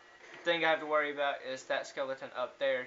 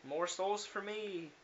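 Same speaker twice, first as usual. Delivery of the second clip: gloating sing-song; 7 semitones higher, much slower than usual. Acted. Gloating over loot.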